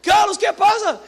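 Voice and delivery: high, loud call to Carlos; shouted greeting. Retold or imitated. Calling out to Carlos over the salsa, shouting. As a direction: yell out his name across the church. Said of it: "Cheguei perto dele e falei".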